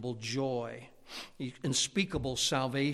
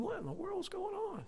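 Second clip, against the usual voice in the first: surprised; 6 dB quieter than usual. Retold or imitated. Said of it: "I'm thinking".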